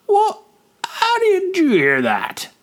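jumps up high in alarm; startled. A narrator's reading. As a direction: caught off guard, voice shooting up. Gullach startled by news.

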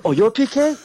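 high, loud astonished question; squawked double-take, played for laughs; mock startled recognition. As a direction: imitate someone caught out, voice leaping up. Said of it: "Like that".

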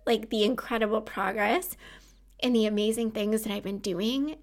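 ordinary talk

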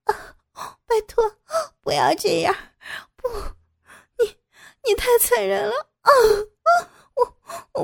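high, sobbing, broken cries; desperate, strained pleading. A narrator's reading. Captive pleads with her cruel captor, anguished crying. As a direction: gasp out tearful protests in fragments. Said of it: "苦撑着".

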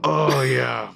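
high, loud laughing "oh yeah"; can barely get it out for laughing; laughing through the words. Acted. Laughing with her friend about the awful wine.

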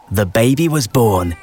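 ordinary delivery

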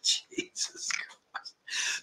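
breathless half-laughing whisper, very soft and slow; real aside whispered away from the mic; whispered exclamation. Real, not acted. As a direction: mutter it under your breath in disbelief. Reacting to a cheeky viewer comment.

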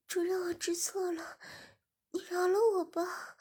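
high, breathless begging breaking into a sob; tearful plea. Acted. Shiya dazed and short of air, begging through a sob. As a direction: beg for mercy on the edge of tears. Dazed pleading, crying heard.

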